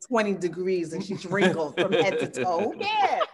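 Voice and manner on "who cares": playful who cares. Real, not acted. Playful mock defiance.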